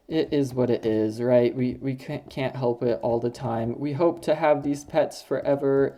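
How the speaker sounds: ordinary delivery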